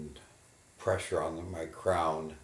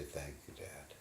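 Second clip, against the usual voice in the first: much softer, composed; soft thanks; 10 dB quieter than usual. Acted. Son quietly thanking his father after composing himself. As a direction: gather yourself and murmur it. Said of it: "I compose myself and say".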